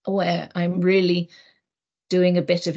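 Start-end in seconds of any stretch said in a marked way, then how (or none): none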